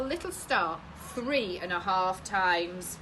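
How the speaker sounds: plain reading voice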